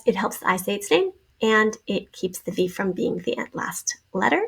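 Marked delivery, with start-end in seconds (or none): none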